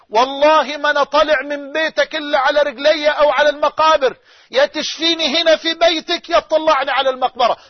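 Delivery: loud, pleading cry to God, building; retold desperate pleading shout; crying out in prayer. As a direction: beg God at the top of the voice, near tears. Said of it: "وقعد يصرخ ويبكي".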